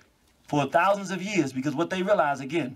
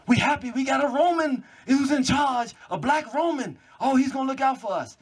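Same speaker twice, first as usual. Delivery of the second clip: high, gullible cheerful voice; mocking the people who laid arms down, high; mock naive joy; 8 semitones higher than usual. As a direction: play the trusting fools, high.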